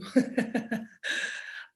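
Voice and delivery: a soft unvoiced laugh at herself; little breathless chuckle; breathy laugh. Real, not acted. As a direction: laugh quietly at your own realisation. Laughs at own realisation.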